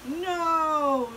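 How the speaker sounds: long, loud, high no; whole crowd's stretched-out yell. A narrator's reading. Crowd refusing to be eagle's lunch, yelling. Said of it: "the crowd yelled".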